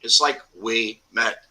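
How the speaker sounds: we met said as two clear words, like we met; slow, careful we met, not Womet; name said right. Shown as a demonstration. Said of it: "people call me Womet".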